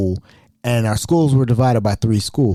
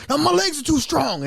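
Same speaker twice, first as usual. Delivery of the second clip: mock wrestler boast; 13 semitones higher than usual. Wrestler refusing to sell.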